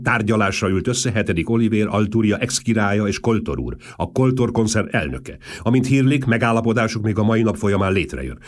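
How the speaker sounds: loud, brisk news dictation; dictating voice. A narrator's reading. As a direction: dictate a wire report. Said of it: "diktáló hangon folytatta".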